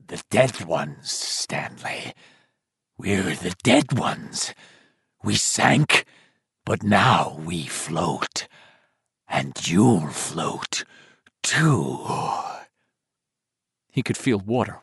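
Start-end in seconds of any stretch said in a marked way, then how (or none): 0.1-12.1 much lower, slow, bubbling ghoul voice; drowned dead voice. Acted. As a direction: gurgle it like a corpse choked with mud. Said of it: "a low, bubbling voice that seemed choked with mud and old water".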